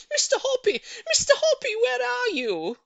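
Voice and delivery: shrill with excitement, calling up from below; a lady yelling upstairs to her neighbour; excited shout. A narrator's reading. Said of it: "she was shouting".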